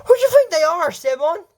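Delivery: loud, high-pitched question; high incredulous retort. Acted. Villain retorts to Esteban, high loud incredulity.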